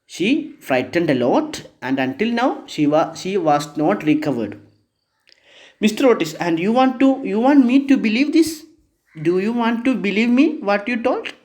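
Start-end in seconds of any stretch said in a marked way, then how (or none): 5.8-11.3 hammed-up doubt, pitched up; incredulous. Lord Canterville's side asks Otis if he must believe this, incredulous. Character doubts Otis's ghost story.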